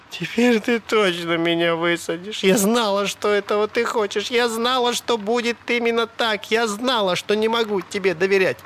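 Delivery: high, hurt woman's voice; high-pitched, wounded insistence; pleading. A narrator's reading. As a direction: plead reproachfully, pitch climbing. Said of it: "широко раскрытыми умоляющими глазами".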